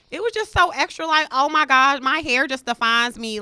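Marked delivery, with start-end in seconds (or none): 1.3-3.4 higher, hammed-up heroine's inner voice; lightly mocked inner-girl voice. Imitating the movie heroine fussing about her hair, lightly mocked inner voice.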